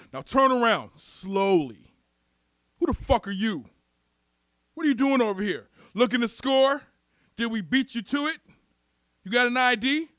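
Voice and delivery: pushy mock police interrogation; cop interrogating. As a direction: challenge him like a suspicious police officer.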